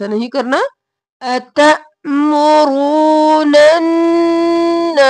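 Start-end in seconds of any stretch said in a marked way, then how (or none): 1.2-5.1 अन्नास held long with a nasal doubled n; nasal ghunna. Shown as a demonstration. Teacher models the right अन्नास for students. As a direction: stretch अन्नास, humming the doubled n. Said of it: "तो गुन्ना होता है".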